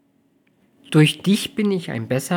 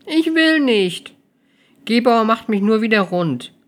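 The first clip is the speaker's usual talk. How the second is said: put-on high, slow childlike whine; whiny; 10 semitones higher, 6 dB louder than usual. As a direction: whine like a reluctant kid. Amelie whines about work.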